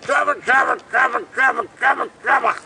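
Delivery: high, loud squawking parrot voice. Shown as a demonstration. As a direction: squawk it like a big-beaked parrot. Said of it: "It makes a".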